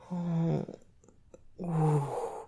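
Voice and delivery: loud drawn-out pleasure moan. Acted. Loud moans in erotic scene.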